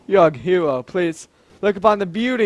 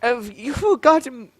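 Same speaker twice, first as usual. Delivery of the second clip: higher, faltering attempt at an old man; half-found old courtier voice; old man voice, slipping; 7 semitones higher than usual. Old councilor starting to challenge the king, voice not quite caught. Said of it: "I've lost the voice for a second".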